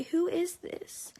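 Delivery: high, slow feigned cluelessness; mocking imitation. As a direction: drag it upward into a mock-innocent question. Mimicking friend's feigned ignorance.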